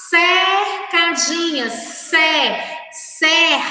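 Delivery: "cercadinha" with CER stretched, starting on S; "cer" held out with a clear S sound; showing the sound. Shown as a demonstration. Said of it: "quando eu falo".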